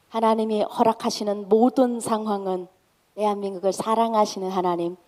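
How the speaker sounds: ordinary talk, nothing marked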